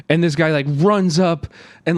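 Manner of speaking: ordinary delivery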